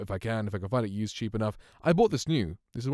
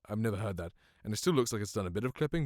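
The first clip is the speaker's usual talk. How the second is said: ordinary talk, nothing marked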